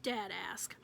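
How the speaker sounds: ordinary delivery, nothing marked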